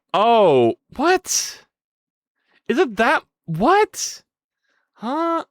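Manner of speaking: high, baffled questions; puzzled at the glitch; real bewildered; confused surprise. Real, not acted. Animation glitch.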